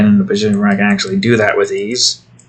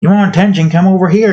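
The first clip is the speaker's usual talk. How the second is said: calling the cat; 6 semitones higher than usual. Real, not acted.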